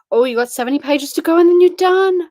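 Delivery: high, loud excited exclaim. Real, not acted. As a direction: burst out with excitement. Reacting to a viewer's reading progress.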